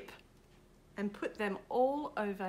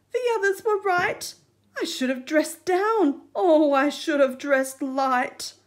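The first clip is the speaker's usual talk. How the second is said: high, woeful regret; lament; 5 semitones higher, 12 dB louder than usual. Acted. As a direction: moan in regret. Crab laments his outfit.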